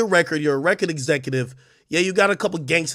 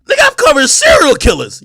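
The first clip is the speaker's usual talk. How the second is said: much higher, loud near-cry; strained high yell; exasperated outburst; 14 semitones higher, 9 dB louder than usual. Real, not acted. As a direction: yell it in disbelief at his critics. Exasperated rant about his coverage.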